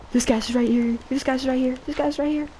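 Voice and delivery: high, urgent repeated warning; gasp, then repeat it in a high panic; panicked alarm. Real, not acted. Gamer spotting enemy right beside them, panicked alarm.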